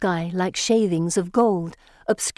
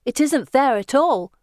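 louder, higher indignant protest; protest, voice rising higher; rising indignation; 6 semitones higher, 4 dB louder than usual. A narrator's reading. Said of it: "her voice rising".

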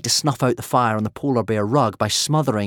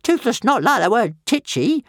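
high, louder huffy little dragon; offended dragon; 13 semitones higher, 6 dB louder than usual. A narrator's reading. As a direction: sulk it out like a proud small dragon.

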